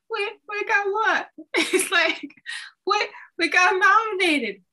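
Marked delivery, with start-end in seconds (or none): 0.1-1.3 high, stammered, disbelieving; hearing the news again, stunned; stunned question. Retold or imitated. 2.8-4.6 high, loud excited yell; excited announcement. Retold or imitated. Friend shouting the news.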